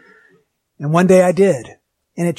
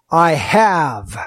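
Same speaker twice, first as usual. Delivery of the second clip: "have" drawn out and set apart; stress on HAVE; 5 semitones lower than usual. Shown as a demonstration. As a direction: lean firmly on "have", then stop. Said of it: "emphasizing certain words".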